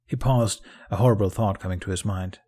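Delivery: ordinary delivery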